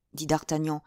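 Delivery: ordinary delivery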